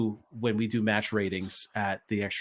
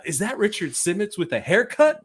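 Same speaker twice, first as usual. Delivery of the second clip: mocking announcer; 10 semitones higher than usual. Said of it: "[name] is like".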